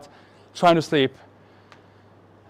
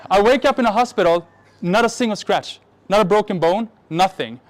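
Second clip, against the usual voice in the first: normal talking voice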